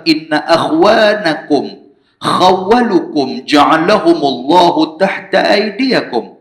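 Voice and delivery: slow, measured Arabic recitation; hadith recitation. Acted. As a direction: recite the hadith slowly and solemnly. (bacaan hadis perlahan dan khusyuk)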